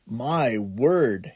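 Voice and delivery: plain reading voice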